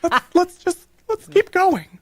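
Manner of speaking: put-on high girly voice, laughing; squeaky girl voice losing it. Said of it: "[name] has completely lost it".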